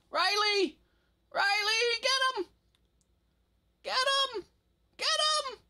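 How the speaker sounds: very high, loud frantic yell; screaming. Real, not acted. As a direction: scream at a teammate to finish it. Screaming at teammate mid-fight.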